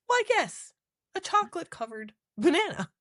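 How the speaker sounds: very high, playful put-on voice; barely holding in a laugh; mock delight. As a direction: play along in a squeaky high voice. Joking retold reply to banana line.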